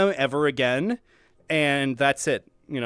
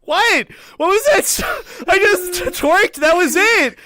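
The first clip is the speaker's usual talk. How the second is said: high, loud, indignant ranting; hammed-up offended shouting about the dance; mock outrage; 11 semitones higher, 5 dB louder than usual. Mock outrage at partner's dance demand.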